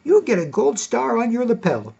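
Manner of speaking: high-pitched mock old lady praising a boy; twinkly old woman. Eighty-year-old woman praising a younger man, imitated twinkly high voice. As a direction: put on a high, smiling granny voice. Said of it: "smile and with a twinkle in her eye and say".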